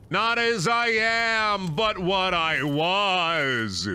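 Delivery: slow, high, theatrical dying plea; dramatic farewell. Pretend. Gamer hams up dying words to chat, slow and grand. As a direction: ham up a grand tragic goodbye, building to a shout.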